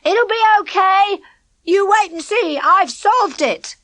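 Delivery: calling back loud and high over his shoulder; boy calling back to reassure a worried friend; shouted back. A narrator's reading. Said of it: "shouted back over his shoulder".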